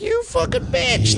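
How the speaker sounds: very high, slow, hammed-up indignant cry; shrill-free but sky-high fake fury; mock outrage. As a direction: explode in mock betrayal. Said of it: "and I'm just like".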